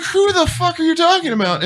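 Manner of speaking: high-pitched mock bewilderment; baffled. Soldiers answering the old fighter's question about Brian, baffled. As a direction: retell it incredulously, cracking up. Retold baffled reply, others laughing.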